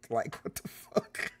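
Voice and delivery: whispered through a laugh; breathy laugh of disbelief. Real, not acted. Reacting to the Republican state, whispered and laughing.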